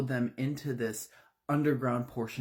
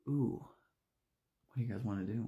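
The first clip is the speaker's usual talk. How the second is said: sly murmur; 5 dB quieter, faster than usual. Acted. Women eye the weapon wall.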